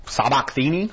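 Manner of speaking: "sabachthani" tried as sah-bok-THEE-nee, rising questioningly; tentative pronunciation. Real, not acted. Teacher attempting a hard Bible word, "sabachthani" as sabok-THEE-nee, tentative pronunciation. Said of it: "the word you could not pronounce".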